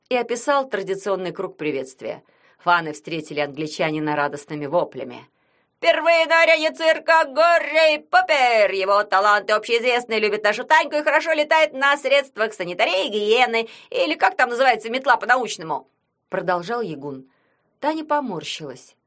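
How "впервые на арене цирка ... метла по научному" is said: sports commentator. Acted. Yagun commenting the match.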